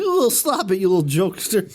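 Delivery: high, teasing mock coo; playful scolding. Courtney walking past the body, playfully scolding. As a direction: brush it off as a silly joke. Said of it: "She's like".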